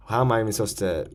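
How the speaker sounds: ordinary talk, nothing marked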